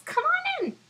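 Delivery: high squeaky little fly; a tiny insect inviting guests. Acted.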